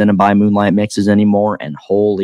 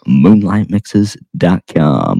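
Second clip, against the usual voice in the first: slow, deep radio announcer; smooth announcer read; 3 semitones lower, 5 dB louder, slower than usual. Pretend. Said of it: "I had to pull out the radio voice for this one".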